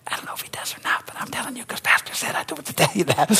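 unvoiced mock whisper of doubt. Congregant whispering doubt to neighbor, mock whisper. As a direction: whisper it like a sheepish confession.

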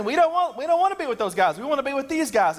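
high, whiny daughters begging Dad; pleading daughters. Retold or imitated. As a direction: pitch way up like protesting girls. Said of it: "they're going to Dad saying".

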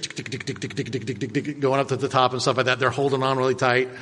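usual speaking voice

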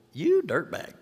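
mock insult. Master insults lazy servant, mock scorn. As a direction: fling the insult playfully.

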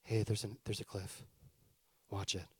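a limp, quiet hey about the cliff; feeble half-hearted warning. Retold or imitated. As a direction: mumble a weak warning you don't mean. Mock timid warning at cliff edge.

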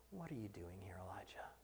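soft, unvoiced voice of God; God's still small voice asking Elijah; gentle whisper. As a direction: whisper the question tenderly. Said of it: "the sound of a gentle whisper".

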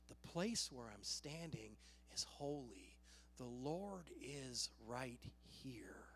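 low, soft realization; acted reverent murmur; hushed awe. Voicing Elijah's awed realization.

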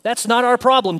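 a lightly voiced, firm Jesus; Jesus's reply. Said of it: "Jesus replied".

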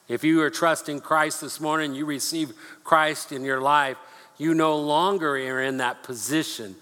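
usual speaking voice

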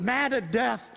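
ordinary talk

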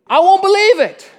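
high, loud stubborn denial; emphatic refusal. Acted. Pastor voicing doubting Thomas, loud insistent refusal. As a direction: Thomas digs in his heels, loud.

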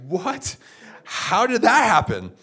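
high, loud, slow astonished question; stunned disbelief. Retold or imitated. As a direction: drag it out in amazed shock. Retold shock at friend's conversion.

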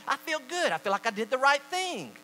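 high-pitched self-satisfaction; self-excusing thought. Acted. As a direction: voice a pleased conscience. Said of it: "or even excuse".

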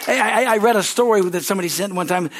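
ordinary delivery

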